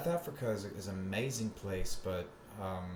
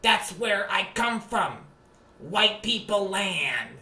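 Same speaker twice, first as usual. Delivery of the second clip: somewhat higher, mocking Sam Hyde voice; 9 semitones higher, 8 dB louder, slower than usual. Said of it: "that dumbass Sam Hyde".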